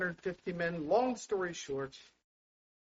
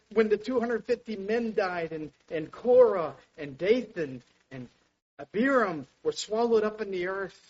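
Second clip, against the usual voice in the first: ordinary talk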